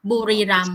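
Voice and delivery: "Buriram" as a Thai teacher says it; native Thai pronunciation. Shown as a demonstration. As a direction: model "Buriram" with Thai sounds for a learner. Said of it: "tell me how to say it".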